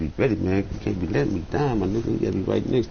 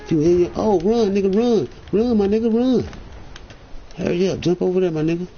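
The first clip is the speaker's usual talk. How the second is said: urgent yelling; 7 semitones higher than usual. Real, not acted. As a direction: yell at him to run. Urging teammate to flee.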